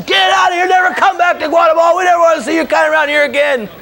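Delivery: very high, hammered-out rant; imitating a furious official in a high monotone yell; mock furious yelling. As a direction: screech it like an enraged border official. Acting the furious border lady's expulsion.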